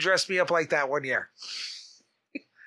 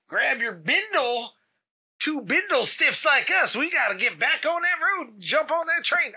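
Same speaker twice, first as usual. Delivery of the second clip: put-on hobo Southern accent; 8 semitones higher than usual. Bindle stiff to his pal by the tracks, Southern accent. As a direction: play an old-time Southern hobo, pitched up. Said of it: "I don't know why they have Southern accents".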